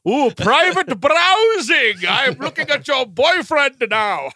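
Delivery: loud, high, stretched-out theatrical gloating; Gomez Addams. Retold or imitated. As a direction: ham it up as a flamboyant patriarch. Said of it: "he's only doing Gomez Addams in this".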